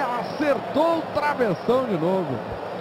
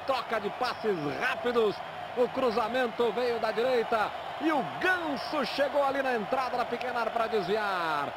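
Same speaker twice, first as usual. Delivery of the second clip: usual speaking voice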